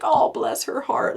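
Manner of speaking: high, sugary mock Southern church lady; a sweet Southern put-down, pitched high; southern saying. Mocking devout Southern gossips, high and saccharine. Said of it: "that Southern little saying where it's like".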